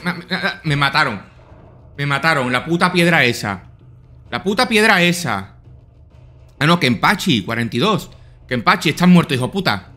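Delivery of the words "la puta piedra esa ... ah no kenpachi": usual speaking voice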